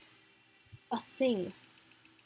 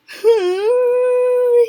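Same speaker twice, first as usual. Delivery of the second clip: high, loud delighted squeal; giddy squeal of laughter; 12 semitones higher, 14 dB louder than usual. Real, not acted. Fangirl squealing at the couple, loud giddy laugh.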